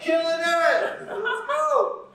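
high, laughing hype shouts; excited cheering. Real, not acted. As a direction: cheer the class on loudly. Coach cheering finished set.

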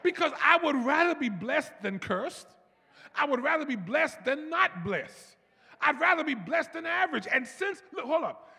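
high, loud, rising sermon climax; full-force preaching. Acted. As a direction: lift the church with every line.

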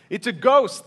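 a touch of startled fright, voiced by a reader; cry of fear. A narrator's reading. Preacher reading the disciples' sighting on the lake, mildly frightened cry. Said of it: "they cried out in fear".

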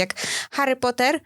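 ordinary talk

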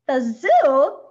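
loud, dismayed cry; miserable exclamation. A narrator's reading. Missus Prim hearing of the zoo, exclaimed miserably. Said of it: "Missus Prim exclaimed miserably".

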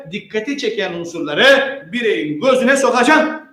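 ordinary talk

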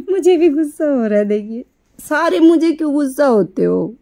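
high-pitched mock protest; playful complaint. Real, not acted. Pet owner asking why all get cross, high playful complaint. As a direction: protest high, half in fun.

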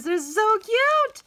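high, loud, slow squeal of delight; gushing excitement. Real, not acted. Crafter gushing over happy mail stickers, squealing with excitement. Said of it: "I'm trying to calm down".

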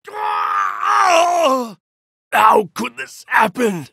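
high, loud anguished cry; shocked, shouting disbelief; defeat scream. Acted. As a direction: scream as you fall.

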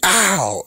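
a short high mock yelp of pain. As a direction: yelp "ow" like you just got smacked. Mock pain cries in retelling.

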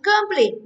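Complete said high, falling on the stressed PLETE; corrective pronunciation. Shown as a demonstration. Teacher correcting a student's reading, high corrective model. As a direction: model Complete firmly, second syllable stressed. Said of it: "viết nào đọc thế chứ".

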